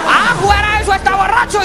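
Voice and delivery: high, loud laughing outburst; outraged shout. Acted. Officer finding out the driver is drunk, high loud outburst. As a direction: burst out in disbelief.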